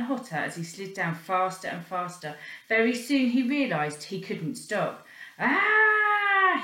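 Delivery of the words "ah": high, loud yelp; boy crying out as he slides; cry of alarm. Acted. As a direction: yelp as the banister burns. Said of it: "he cried".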